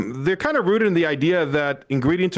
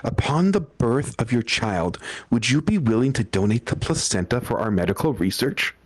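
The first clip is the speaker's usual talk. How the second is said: eerie yoga teacher; 4 semitones lower than usual. Retold or imitated. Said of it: "she's like".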